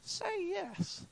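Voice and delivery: high, soft inner voice urging; a tiny high whisper-like voice in the head. Retold or imitated. Brain's inner voice urging him, high and soft. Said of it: "something in my brain just went".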